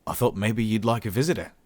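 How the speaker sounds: lifted Australian taunt; Australian human Adrian. A narrator's reading. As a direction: goad the prisoner in an Australian accent.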